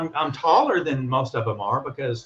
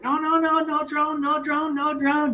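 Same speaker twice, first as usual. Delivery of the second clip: mock yelling security guard, much higher voice; 13 semitones higher than usual. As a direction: squeak out "no drone" like the frantic guard, high up.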